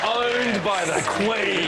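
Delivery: milking the punchline; slow, savoured line. Acted. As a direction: stretch it out with relish. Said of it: "repeated catchphrase".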